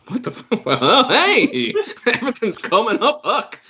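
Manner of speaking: high, giddy mock astonishment with laughing; high-pitched, hammed-up happy shock; shocked to be alive. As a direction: play a creature amazed it survived. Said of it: "it's shocked to be alive".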